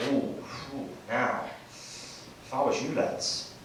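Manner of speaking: lightly voiced old local, slow and hushed; a hint of a knowing old man taking his time; wise old local. Comic telling lads at the bar, lightly voiced slow old local.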